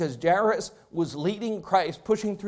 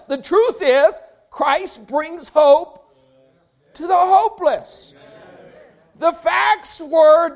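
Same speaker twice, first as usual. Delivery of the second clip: louder, higher, slow hammered preaching; full-force preaching; 7 semitones higher, 8 dB louder, slower than usual. Acted. Preacher contrasting facts and truth, full force. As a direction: drive each phrase home to the church.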